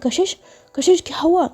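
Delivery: high, alarmed calling of her name; panicked concern; a touch of worry; worried. Acted. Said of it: "उससे बोलती है".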